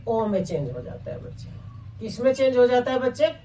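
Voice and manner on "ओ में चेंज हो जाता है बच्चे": low answer voice. Shown as a demonstration. Teacher answering her own question for the pupils, low and soft. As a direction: lower and softer, the pupils' answer. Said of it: "ये किस में change हो जाता है".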